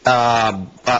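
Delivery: ordinary talk, nothing marked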